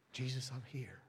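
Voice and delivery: softly hushed, simple prayer; quiet private prayer. Acted. The brief lunchtime prayer, acted.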